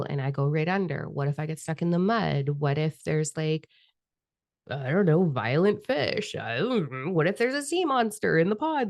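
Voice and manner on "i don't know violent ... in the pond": mock fear. Imagined worst case scenario.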